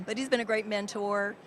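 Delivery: usual speaking voice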